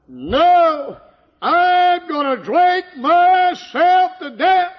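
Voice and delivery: high, loud, slow yell; defiant shout. Retold or imitated. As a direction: bellow it out in defiance. Drunk husband's defiant reply.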